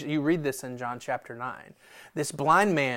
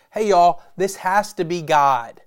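loud blind man; 4 semitones higher, 8 dB louder than usual. Acted. Blind man voiced teaching Pharisees.

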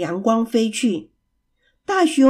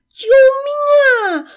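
shouting help; 6 dB louder, much faster than usual. Acted. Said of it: "他们同时大喊".

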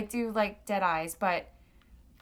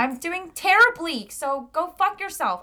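mock outburst; 7 semitones higher, 5 dB louder than usual. Mock honest reply to small talk.

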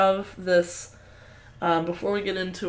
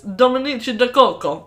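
louder, lilting Italian name; mock Italian accent; 5 semitones higher, 5 dB louder than usual. Host quoting Omar giving his fake name, elegant Italian accent. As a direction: say it in a showy, beautifully Italian accent. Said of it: "he says his name beautifully".

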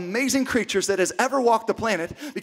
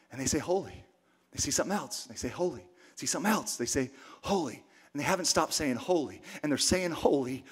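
much softer, lower, awed hush; 7 semitones lower, 10 dB quieter than usual. Real, not acted. Pastor describing the angels' endless praise in a hush.

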